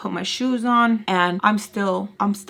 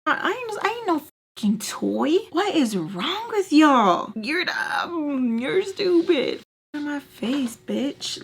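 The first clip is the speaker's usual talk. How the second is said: angry retort; 6 semitones higher, slower than usual. Retold or imitated.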